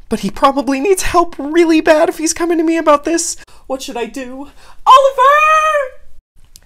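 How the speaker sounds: high, loud panicking mother calling out; frantic panic. Acted. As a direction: scream for help in panic. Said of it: "Panic immediately sets in for Nanako".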